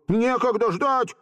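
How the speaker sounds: put-on high-pitched frantic boy; desperate cry. A narrator's reading. Volka unable to wait for chess, desperate. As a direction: exclaim it in despair. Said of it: "воскликнул с отчаянием Волька".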